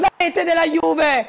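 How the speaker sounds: shouted, high goal call; breathless italian goal commentary. Acted. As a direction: bellow the late winner. Late winning goal called.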